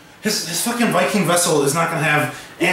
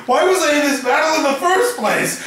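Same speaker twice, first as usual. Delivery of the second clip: higher-pitched comic complaint; mock exasperation; 7 semitones higher than usual. Victor running from battle, higher-pitched mock complaint. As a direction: wail it as a hammed-up protest.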